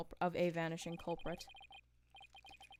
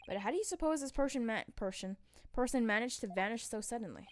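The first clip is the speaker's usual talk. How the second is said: ordinary delivery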